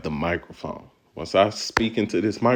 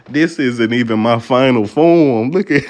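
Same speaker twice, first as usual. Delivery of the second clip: gloating villain; 4 semitones higher, 9 dB louder than usual. Retold or imitated. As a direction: boom it out like a final boss. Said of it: "I'm feeling like a villain".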